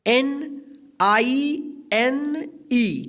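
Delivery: slow, stretched letters; spelling out. Shown as a demonstration. Teacher spells a word for pupils, slowly letter by letter. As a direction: give every letter its own long beat.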